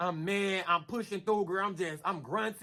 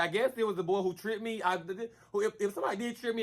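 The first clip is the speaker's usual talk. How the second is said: usual speaking voice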